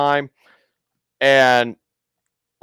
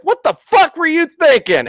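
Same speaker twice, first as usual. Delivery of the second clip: daughter's outburst. Retold or imitated. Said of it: "She's like".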